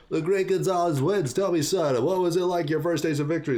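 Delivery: fight announcer. Acted. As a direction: project like a post-match MC. Acting announcer interviewing the winner.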